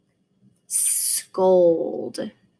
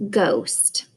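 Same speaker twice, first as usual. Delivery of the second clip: ordinary talk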